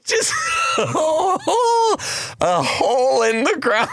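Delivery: high shrieking laugh around the words; crying with laughter at the story; squealing laughter, words breaking through; helpless laughter. Real, not acted. Helpless laughter over fall.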